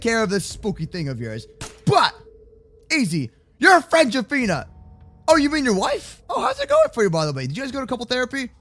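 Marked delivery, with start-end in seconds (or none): none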